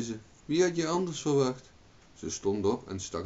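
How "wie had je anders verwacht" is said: indignant retort. A narrator's reading. Margaret retorts to husband.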